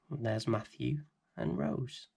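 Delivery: plain reading voice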